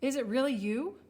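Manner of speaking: awed, rising astonishment; gasping in amazement. A narrator's reading. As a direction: ask in hopeful disbelief. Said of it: "he gasped".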